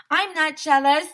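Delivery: high, loud denial; shouted. A narrator's reading. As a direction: shout it back at him. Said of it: "Andrea shouted".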